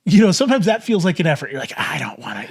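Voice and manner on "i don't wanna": a much lower, softer, grudging I don't wanna; whiny reluctance. Retold or imitated. Viewer grumbling about subscribing, low and reluctant. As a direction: sulk it out like a bored viewer.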